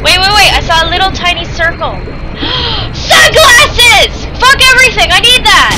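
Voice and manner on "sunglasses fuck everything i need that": excited shout. Real, not acted. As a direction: shout it out like you found treasure. Spots sunglasses in the game.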